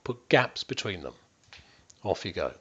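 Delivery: ordinary talk, nothing marked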